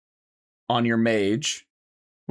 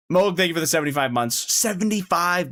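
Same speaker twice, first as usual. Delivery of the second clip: excited; 6 semitones higher than usual. Real, not acted. Thanking a sub with excitement.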